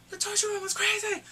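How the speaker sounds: high, loud squeal of disbelief; excited outburst. Real, not acted. Reviewer tells viewers about the torture room, high excited outburst.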